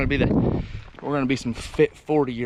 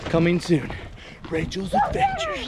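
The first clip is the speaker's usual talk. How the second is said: announcer voice; 9 semitones higher than usual. Pretend. Announcing a show title.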